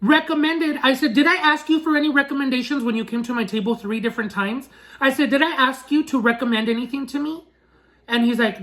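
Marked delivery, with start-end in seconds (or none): 0.0-7.4 higher-pitched indignant challenge; retold angry comeback, pitched up; pissed off. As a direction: fire back rhetorical questions, affronted. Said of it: "So that pissed me off".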